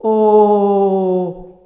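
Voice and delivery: long sustained O. Shown as a demonstration. Teacher sustaining the O vowel. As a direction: hold the O steady. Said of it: "This is the O".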